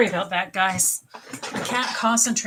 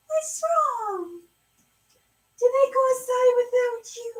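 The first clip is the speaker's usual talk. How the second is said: very high-pitched pet voice, sing out to the cat; 3 dB louder than usual. Real, not acted. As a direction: coo in falsetto to comfort a crying cat. Baby-talking her crying cat.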